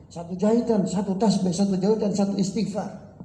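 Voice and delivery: high, pleading protest from the tailor; the tailor cries out that the work took him ages; indignant tailor. Acted. Tailor protesting to the buyer, high and aggrieved.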